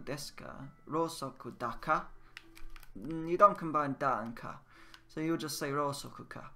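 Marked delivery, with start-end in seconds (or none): none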